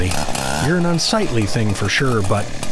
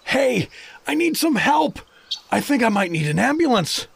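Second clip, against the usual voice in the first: calling for help; 9 semitones higher than usual. Acted.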